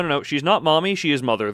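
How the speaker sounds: normal talking voice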